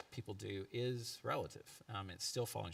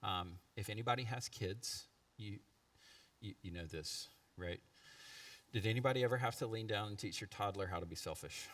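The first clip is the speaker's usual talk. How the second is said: ordinary delivery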